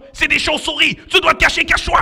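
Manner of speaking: high, loud playful fright; mock alarm. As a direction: sound the bat alarm to the kids. Bats appear, hide now.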